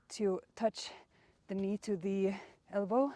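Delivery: ordinary talk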